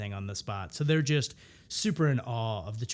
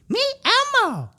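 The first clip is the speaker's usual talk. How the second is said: Elmo voice; 10 dB louder, faster than usual. Pretend. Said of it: "That's how I've just always done the Elmo voice".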